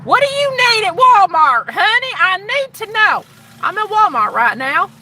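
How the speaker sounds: hammed-up Southern US call, rising high and loud; high, loud put-on Southern twang; southern drawl. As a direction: holler to your honey in a southern drawl. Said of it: "I gotta go in with my southern drawl".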